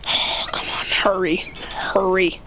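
hurried, scared whisper; urgent whisper. Real, not acted. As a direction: rush it under your breath. Urgent whisper while hiding.

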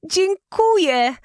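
wysoko, głośno i przeciągle z radości; uszczęśliwiona. A narrator's reading. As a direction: wykrzyknij szczęśliwe podziękowanie. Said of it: "zawołała uszczęśliwiona Anna". (Anna dziękuje wiedźmie, rozpromieniona i głośna)

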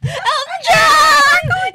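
slow high shouted reveal; overjoyed yell; excited shout. Retold or imitated. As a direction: shout it out, drawn out. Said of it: "then she's like".

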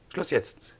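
mildly exasperated character voice; exasperated order. Acted. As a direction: put an end to the squabble. Professor cuts off the quarrel.

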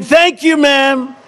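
lightly voiced polite snake. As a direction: fake courtesy from the snake. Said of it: "instead of saying".

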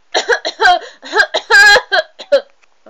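loud, hammed-up hacking cough; mock rude cough. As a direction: cough right at them, overdone. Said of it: "I'm probably gonna do the worst impression here".

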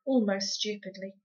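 plain reading voice

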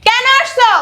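very high, loud, falling yell; furious shout. Acted. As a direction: shout the demand in a rage. Repeats demand, escalating. (রাগে চিৎকার করে কৈফিয়ত চাওয়া)

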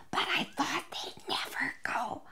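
hushed, playful aside; confiding whisper. Pretend. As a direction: whisper it behind the guests' backs. Confiding about guests who stayed late.

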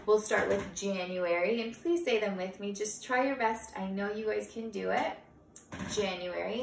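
usual speaking voice

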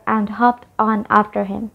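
usual speaking voice

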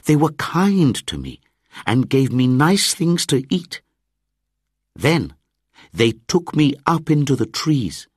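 put-on high-pitched boy pouring out his story; defiant child. A narrator's reading. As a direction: protest in a high, insistent child's voice. Mowgli voiced as defiant child.

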